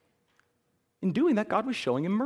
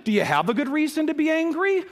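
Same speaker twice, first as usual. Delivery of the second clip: raised challenge; 9 semitones higher than usual. Acted. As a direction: throw the question out to the room. Preacher challenges the congregation.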